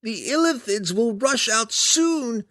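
high, slow, loud creature voice; monster warning. A narrator's reading. Hook Horror warning Belwar of Illithids, high creature voice. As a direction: warn slowly in a high monster voice. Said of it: "Clacker continued".

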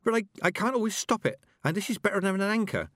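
put-on high, nervous young girl; a nervous girl babbling excuses; apologising after crashing her broom; flustered girl. A narrator's reading. Petulia flustered after crash landing.

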